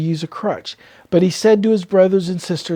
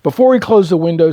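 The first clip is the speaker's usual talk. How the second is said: ordinary delivery, nothing marked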